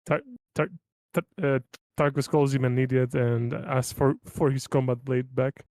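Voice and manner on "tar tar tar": normal talking voice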